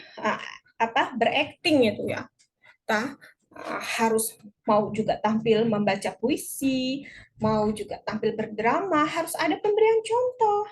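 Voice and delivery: usual speaking voice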